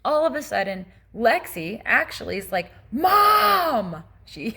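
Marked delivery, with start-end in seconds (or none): 2.9-4.0 loud, high, drawn-out call; retold yell. Daughter trying to get through on a bad phone line, yelled. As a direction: imitate a daughter yelling for her mother. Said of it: "she yelled".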